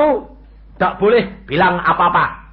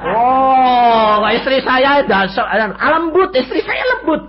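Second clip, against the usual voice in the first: much higher, drawn-out fond praise; high excited tribute to his wife; high fond exclamation; 9 semitones higher, 5 dB louder, slower than usual. Real, not acted. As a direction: gush about his gentle wife.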